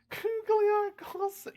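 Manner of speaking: high, slow words through giggles; laughing. Real, not acted. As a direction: giggle out the name. Laughing at helmet glasses.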